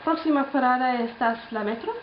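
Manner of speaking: put-on careful learner's Spanish accent; Spanish phrase. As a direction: tourist trying out Spanish accent. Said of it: "asked a question of a Spanish lady in Spanish".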